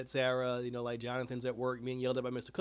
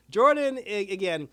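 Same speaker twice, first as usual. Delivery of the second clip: exasperated outburst; 6 semitones higher, 9 dB louder, slower than usual. Real, not acted. Exasperated outburst about Jordan.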